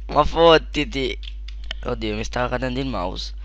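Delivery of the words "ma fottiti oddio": ordinary talk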